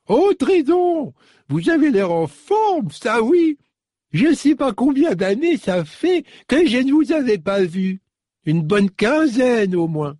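put-on very high, cracked old lady voice, welcoming; delighted old woman. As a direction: greet a long-lost girl at the door. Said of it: "tout éraillée par la vieillesse".

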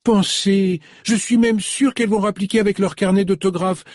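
put-on high, delighted young saleswoman; young shop girl, high and delighted; eager shop girl. A narrator's reading. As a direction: gush like a thrilled young shop assistant. Marlène voice again.

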